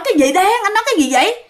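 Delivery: high-pitched acted disbelief; baffled questioning. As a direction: imitate an alarmed, puzzled question. Acted baffled question to her brother-in-law. (giả giọng hỏi dồn, ngơ ngác, cao)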